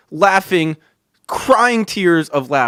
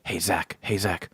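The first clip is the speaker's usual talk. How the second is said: hushed, softer repeated name; whispered call; 9 semitones lower, 12 dB quieter than usual. Retold or imitated. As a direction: whisper so the teacher won't hear. Larry whispering to Zach in class.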